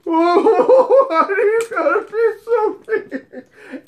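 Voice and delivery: high, wailing laugh-cry, put on; mock bawling. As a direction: whine it like a drunk bursting into tears. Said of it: "she starts bawling her eyes out".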